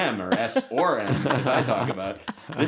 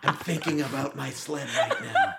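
laughing through a mock dying line; 2 semitones higher, 4 dB quieter than usual. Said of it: "It was".

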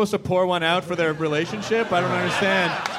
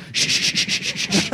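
shushing. Retold or imitated. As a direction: shush the crowd like a cop. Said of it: "he'd be like".

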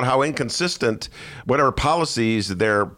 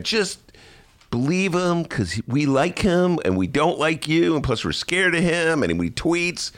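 put-on higher, slow Valley Girl sarcasm; sarcastic Valley Girl; 7 semitones higher, slower than usual. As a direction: drawl it like a mocking Valley Girl. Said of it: "Valley Girl accent to mimic what sarcasm sounds like".

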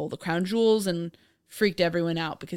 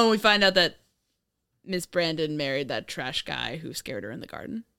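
usual speaking voice

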